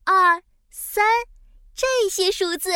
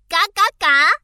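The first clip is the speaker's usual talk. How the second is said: loud quacking 嘎嘎嘎; duck quack; 7 dB louder, faster than usual. Acted. (大声学鸭叫)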